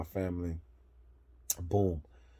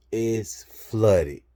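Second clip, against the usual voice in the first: exasperated; 3 semitones higher, 9 dB louder than usual. Real, not acted. Discovers trailer flooded.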